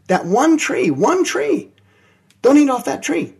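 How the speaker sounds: ONE hammered high; high, insistent repeat of "one tree"; stressed command. Acted. God quoting his command.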